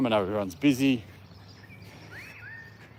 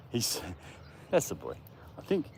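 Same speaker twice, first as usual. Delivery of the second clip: ordinary delivery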